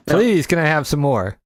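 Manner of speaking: laughing sarcastic begging; laughing mock plea. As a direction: plead like a hungry orphan while laughing.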